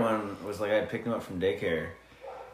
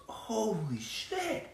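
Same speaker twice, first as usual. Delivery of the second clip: put-on kid's exclamation; 13 semitones higher, 5 dB quieter, much slower than usual. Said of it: "one of the boys goes".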